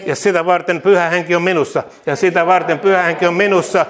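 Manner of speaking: loud, fervent call-and-repeat proclamation; rousing preacher declaring victory; preaching declarations. Acted. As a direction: proclaim each line boldly for the church to echo.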